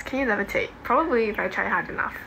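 normal talking voice

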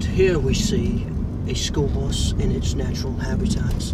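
nature documentary narrator. Pretend. Husband narrating the school bus as wildlife, documentary voice. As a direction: present the bus like a rare animal.

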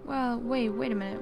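usual speaking voice

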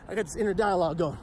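ordinary talk

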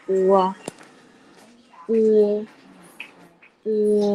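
drawn-out, level-pitched model of "อัว"; demonstrating pinyin sound. Shown as a demonstration. Chinese teacher modelling initials for pupils, drawn-out level "อัว". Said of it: "อ่านว่า".

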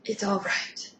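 soft whispered forgiveness. Acted. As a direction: breathe it out gently. Molly softly forgives unicorn.

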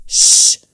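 short, forceful "shh"; shush. Acted. Thug silences crying Zeina, sharp shush. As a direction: hush her abruptly.

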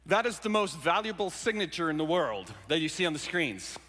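usual speaking voice

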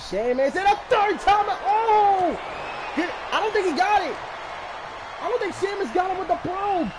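high, fast excited commentary; excited shouting. Real, not acted. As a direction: yell it out like the match is slipping away. Reacting live to wrestling match.

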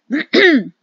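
loud, high throat clear; stalling, forced ahem. A narrator's reading. Captain stalls before answering.

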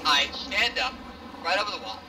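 usual speaking voice

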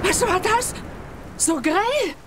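startled spectator crying out at the flash. Acted. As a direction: jump at the sudden flash.

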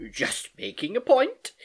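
high, slow, teasing reply; playful mock innocence, drawn out; mischievous. A narrator's reading. Uncle teasing his soaked niece, mischievous. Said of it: "he replied mischievously".

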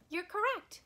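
high, bright cheer; cheerful praise. Acted. Librarian cheering kids who guessed the trivia answer.

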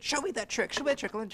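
high, eager pleading voice; begging Coyote. Acted. Coyote begs the rabbit for the trick, high pleading. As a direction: beg for the trick like a whiny trickster.

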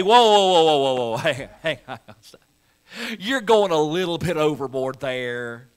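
lightly voiced, laughing whoa whoa, slowing down; a hint of an embarrassed Jesus waving it off; playful pretend protest; mock humble Jesus. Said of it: "that he has to say".

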